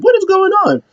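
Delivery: high-pitched mock yell; retold yelling. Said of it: "he's just hears yelling".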